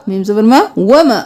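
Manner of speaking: ordinary delivery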